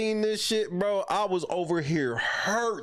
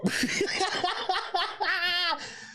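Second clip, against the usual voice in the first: high laughter; 10 semitones higher than usual. Real, not acted. Cracking up at the clip.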